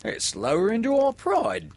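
high, loud pirate complaint; indignant crewman. A narrator's reading. Pirate disowning his humbled captain, indignant. Crew grumbles about their captain.

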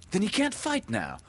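put-on high-pitched boy's voice; high, childlike interjection; boy's objection. A narrator's reading. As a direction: pipe up high as a young boy. John questions Peter about Hook.

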